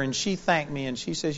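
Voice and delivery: ordinary delivery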